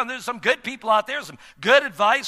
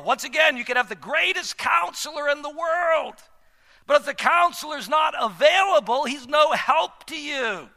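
preaching; 6 semitones higher than usual. Acted. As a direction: preach it with rising force. Preaching at full force.

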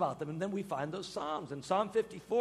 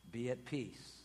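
soft reassurance; 8 semitones lower, 10 dB quieter than usual. Real, not acted.

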